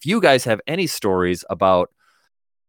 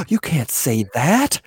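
mock shock; 4 semitones higher, slower than usual.

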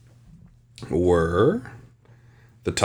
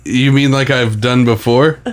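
ordinary delivery

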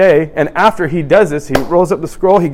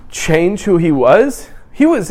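usual speaking voice

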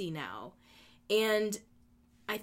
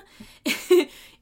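laugh; 10 semitones higher, 12 dB louder than usual. Real, not acted. Laughs mid-story about tennis.

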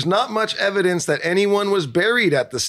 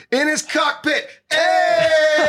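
shouted punchline; 11 semitones higher, much slower than usual. Acted. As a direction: yell the punchline triumphantly. Punchline of a dirty joke.

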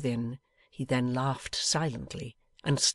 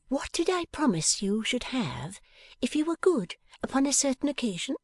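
put-on higher playful young woman; 11 semitones higher than usual. A narrator's reading. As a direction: coax him like a teasing daughter. Bella voiced.

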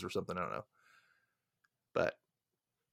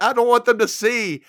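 high, loud frightened plea; paranoid panic; 10 semitones higher, 8 dB louder than usual. Retold or imitated. Hiding from them, he pleads frantically with his brother. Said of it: "He's like".

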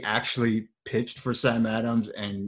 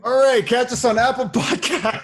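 outro announcer; 13 semitones higher, 7 dB louder than usual. Acted. Signing off the podcast.

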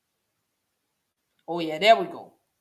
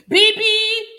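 excited call; 8 dB louder than usual. Real, not acted. Excited over niece's message.

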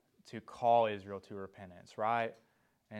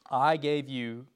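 I said firmly and louder; emphatic I; 3 semitones higher, 6 dB louder than usual. A narrator's reading. Preacher restarts God's words from Amos, emphatic I. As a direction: put the weight on I. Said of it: "It's called the emphatic pronoun".

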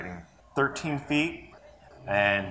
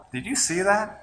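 surprised, laughing; 9 semitones higher, 3 dB quieter than usual. Retold or imitated. Retold shocked reaction, laughing.

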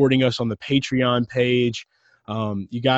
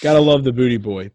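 ordinary talk

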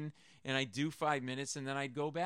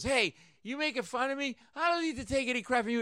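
put-on angry drunk; 10 semitones higher, 5 dB louder than usual. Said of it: "he goes".

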